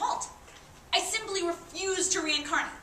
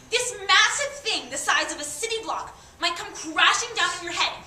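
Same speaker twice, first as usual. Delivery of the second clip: building from raised voice to high, loud shouting; building rant; 5 semitones higher, 8 dB louder than usual. Acted. Doomed dead speaker lists who might be crushed, escalating rant. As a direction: get louder and higher with each item in the list.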